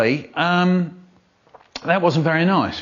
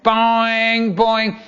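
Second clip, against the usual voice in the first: bell imitation; 9 semitones higher than usual.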